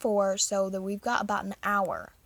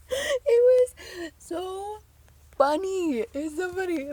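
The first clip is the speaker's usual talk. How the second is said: laughing; 9 semitones higher, 3 dB louder, slower than usual. Real, not acted. Laughing recounting.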